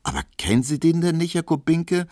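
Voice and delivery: quick, high, surprised cry; exclaimed. A narrator's reading. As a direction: call out amazed that he doesn't know. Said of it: "rief Pauline".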